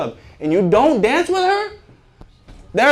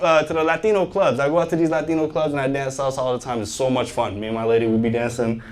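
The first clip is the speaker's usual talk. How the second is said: ordinary talk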